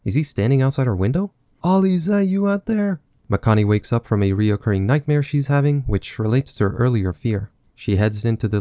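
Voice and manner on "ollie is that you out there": pitched-up put-on heroine voice; high character voice. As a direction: call out nervously in a high voice. Voicing the heroine at the window.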